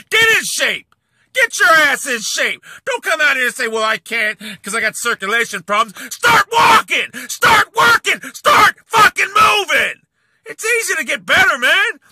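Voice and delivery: screaming get moving at a lazy rival; furious shouting. Real, not acted. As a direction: bellow each command at him. Yelling fitness advice at rival.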